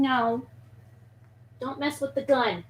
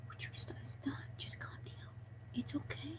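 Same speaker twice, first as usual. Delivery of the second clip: whispered reassurance to a pet; barely audible calming murmur; soothing whisper; 22 dB quieter than usual. Real, not acted. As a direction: hush and comfort it very softly. Soothing the snake aside.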